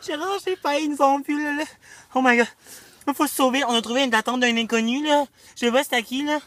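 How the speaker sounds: high, loud, panicked; breathless panic, fleeing; being chased. Pretend. Fleeing pursuers in game.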